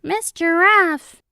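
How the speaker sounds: excited high call; crowd shout. A narrator's reading. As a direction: shout the star's name like a crowd. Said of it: "All the animals shouted".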